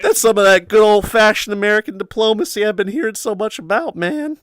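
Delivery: high-pitched, loud cartoon slacker voice; hammed-up, high cartoon impression; Shaggy impression. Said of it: "I can't do Shaggy".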